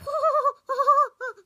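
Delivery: a burst of high mocking laughter; high shrill laugh. Mocking a neighbour's shop with a loud high laugh. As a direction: cackle high at the idea of a shop. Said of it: "why are you laughing at me".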